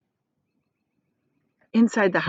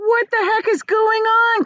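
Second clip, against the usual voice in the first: much higher, louder woken cry; startled cry; 5 dB louder than usual. Acted. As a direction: shout out in alarm. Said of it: "cried Grandma Josephine".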